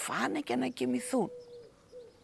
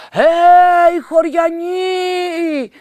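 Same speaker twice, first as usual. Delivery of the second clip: much higher, much louder, slow drawn-out cries; shouting for help; 9 semitones higher, 15 dB louder, much slower than usual. A narrator's reading. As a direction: yell across the valley to the villagers. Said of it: "αρχίζει να φωνάζει".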